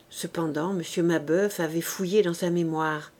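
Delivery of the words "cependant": ordinary delivery, nothing marked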